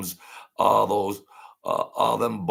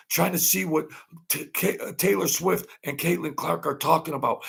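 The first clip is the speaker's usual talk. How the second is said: high, dramatic announcer build-up; high, hammed-up sports announcer; mock broadcaster hype; 7 semitones higher than usual. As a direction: sell the suspense like a cheesy broadcaster.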